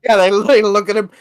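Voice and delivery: ordinary delivery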